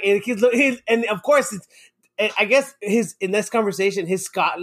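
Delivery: normal talking voice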